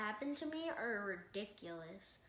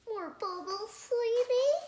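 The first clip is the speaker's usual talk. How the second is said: doting parent; 11 semitones higher, 9 dB louder than usual. Retold or imitated. Parent voiced, Greg's babyhood.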